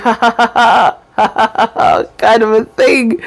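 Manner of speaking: fast, running string of ha-has; her extra laugh. Shown as a demonstration. Showing viewers her post-injury laugh, fast extended laughing. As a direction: take the demonstrated laugh an extra step. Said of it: "But mine is gonna be".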